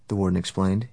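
ordinary delivery, nothing marked

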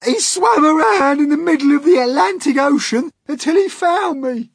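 very high, loud excited outburst; gasped. A narrator's reading. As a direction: tell of the rescue at a high-pitched pitch of amazement. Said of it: "gasped the centipede".

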